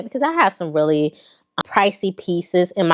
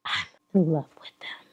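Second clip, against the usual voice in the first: whisper; 3 semitones lower, 10 dB quieter than usual. Real, not acted. Whispered confession about boots.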